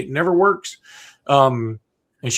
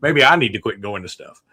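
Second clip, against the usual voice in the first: lower, conceding defeat; sheepish concession; 5 semitones lower than usual. Retold or imitated. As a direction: own the blame sheepishly. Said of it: "I was like".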